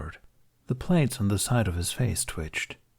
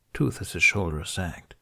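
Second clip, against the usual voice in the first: ordinary delivery, nothing marked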